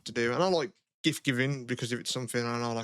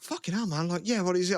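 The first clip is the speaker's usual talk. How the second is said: higher, eager what is it; mock excited reaction; 6 semitones higher than usual. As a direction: play the thrilled friend, pitched up. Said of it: "Instead of being like".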